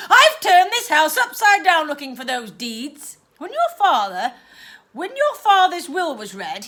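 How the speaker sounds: aunt complaining in frustration; frustrated aunt. Acted. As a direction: fume about the missing deeds. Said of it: "stamped her feet ... in frustration".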